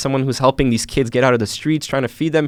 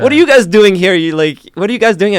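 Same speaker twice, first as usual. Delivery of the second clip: higher, louder, startled question; shocked surprise; 8 semitones higher, 6 dB louder than usual. Retold or imitated. Malik surprised to see the kids at the police office, loud startled question. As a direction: blurt it out in disbelief.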